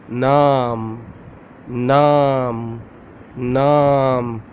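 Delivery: Naam with a long open "aa"; Urdu word. Shown as a demonstration. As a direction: stretch the aa in Naam slowly. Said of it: "How to say this word?".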